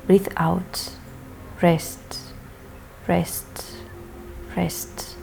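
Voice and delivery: slow hypnotic relaxation voice fading to a whisper; mesmerizing. Shown as a demonstration. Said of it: "for example".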